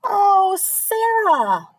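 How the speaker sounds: high, loud, drawn-out delighted greeting; gushing. A narrator's reading. Principal greeting Sarah at the car, gushing. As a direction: swoop from high and gush over the child. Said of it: "Missus Burton gushed".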